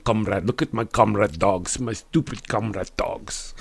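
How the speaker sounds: put-on low-key, hammy Russian accent; practice Russian accent. Streamer practising on the dogs while walking, mock Russian accent. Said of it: "So I can get a good Russian accent".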